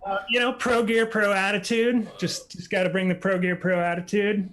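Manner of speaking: distorted, overloaded microphone; too loud mic. Real, not acted. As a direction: speak right into a hot mic. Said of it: "maybe turn your mic down just ever so slightly".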